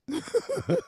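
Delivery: short squeaky laugh; laughing at his own tall tale; high burst of laughter. Real, not acted. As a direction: let a high laugh pop out.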